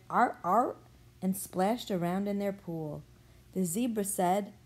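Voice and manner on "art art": seal barks. A narrator's reading. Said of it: "They said".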